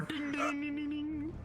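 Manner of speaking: high bell imitation; high ding ding. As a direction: sing the dings like a bell. Mimics bell sound.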